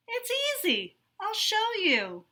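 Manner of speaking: eager to teach a friend; lightly voiced helpful girl; friendly classmate. Friendly classmate offers help.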